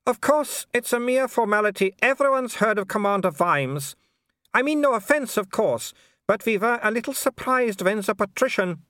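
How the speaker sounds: high, polite baroness voice with a thin smile; a smiling aristocrat who means none of it; cool hostess smile. A narrator's reading. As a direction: welcome him coolly through a fixed smile.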